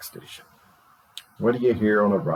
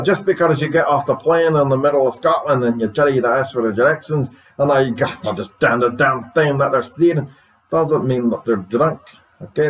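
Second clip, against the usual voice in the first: guest in a put-on Scottish accent; 4 semitones higher than usual. Scottish guest thanks radio host, Scottish accent. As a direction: greet the host with a Scottish brogue. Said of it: "is every Scotsman drink".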